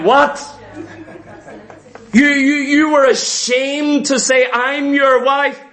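a wife hurt at being disowned; indignant wife. Acted. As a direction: play the stung wife.

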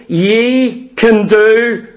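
ye can do, stretched slow and left hanging; slow it right down and let the church finish it; very slow, leaving a gap for the answer; drawn-out cue to congregation. Acted.

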